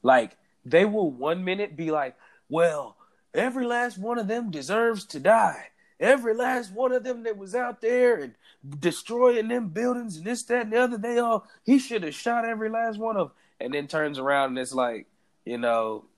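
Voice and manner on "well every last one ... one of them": high-pitched, indignant mock Trump supporter. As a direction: put on a high, ranting MAGA-hat voice. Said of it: "That was my Trump supporter voice".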